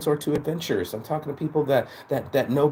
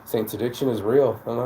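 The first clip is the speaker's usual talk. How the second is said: usual speaking voice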